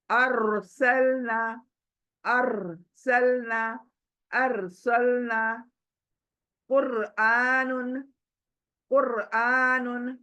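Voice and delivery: अरसलना very slow, र clear and thick; clear heavy ra. Shown as a demonstration. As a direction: stretch each word, र heavy and clear. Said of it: "clear पढ़ना है". (र साफ़ और मोटा, बहुत धीरे)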